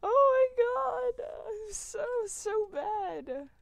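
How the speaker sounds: very high, loud, slow wail; despairing wail. Real, not acted. Despair after losing items.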